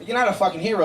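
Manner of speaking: normal talking voice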